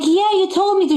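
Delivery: pushing back in disbelief; exasperated. Retold or imitated. Daughter answering her critical mother, exasperated. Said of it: "I'm like".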